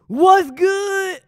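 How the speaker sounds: loud hype greeting to chat; excited shout. Real, not acted. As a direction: belt it out like a hype streamer.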